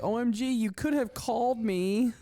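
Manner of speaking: high-pitched mimicry of an indignant complaint; mock outraged woman. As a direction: imitate her protest way up high. Mimicking absent Jen's reaction.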